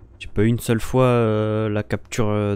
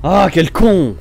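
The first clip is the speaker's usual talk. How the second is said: annoyed; 5 semitones higher, much slower than usual. Real, not acted. Insulting an enemy player.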